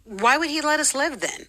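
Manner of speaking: high-pitched incredulous question; incredulous challenge. Retold or imitated. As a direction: challenge it in pained disbelief. Retold challenge over racist scriptures.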